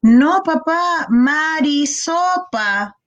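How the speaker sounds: put-on a child protesting to dad, stretched slowly; insistent child. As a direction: drag out "Marisopa" like a stubborn little kid. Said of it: "Es como, ¿cómo no entiendes?".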